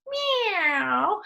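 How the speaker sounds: high, long gliding meow; high-pitched feline cry; cat meow. Shown as a demonstration. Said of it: "All of those are great sound words".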